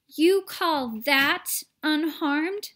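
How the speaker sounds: snapped. A narrator's reading. Mother snapping at Wonka, slow and angry. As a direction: snap it back in disbelief. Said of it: "snapped Missus T V".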